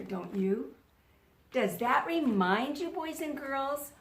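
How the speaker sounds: normal talking voice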